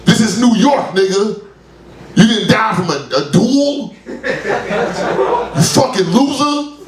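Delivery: mock the loser, slow and sneering; jeering at a man who died of a stroke; scornful taunt. Mocking a man dead from stroke.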